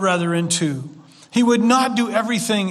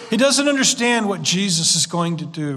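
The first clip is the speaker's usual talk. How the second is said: ordinary talk, nothing marked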